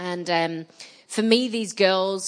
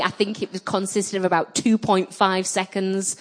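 ordinary delivery

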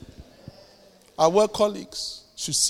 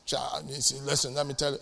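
grumbling aside; 5 semitones lower, 9 dB quieter than usual. Retold or imitated. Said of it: "They start complaining".

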